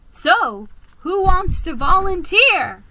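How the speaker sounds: high, loud squeaky call. Acted. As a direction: squeak it out brightly. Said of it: "I squeaked".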